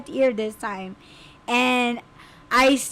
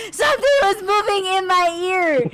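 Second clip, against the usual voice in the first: laughing panic; 6 semitones higher than usual. Retold or imitated. Telling him, laughing, crying.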